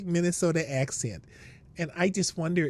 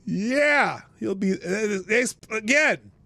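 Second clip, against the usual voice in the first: normal talking voice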